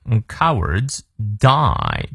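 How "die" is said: "die" with its diphthong drawn out fully; full diphthong. Shown as a demonstration. Teacher models the double vowel for learners, long full "die". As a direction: stretch "die" slowly through the whole vowel glide. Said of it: "注意双元音I念到位".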